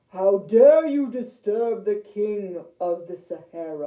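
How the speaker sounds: loud, outraged lion king; big booming voice. A narrator's reading. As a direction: boom it out like an offended king. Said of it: "a very big booming voice".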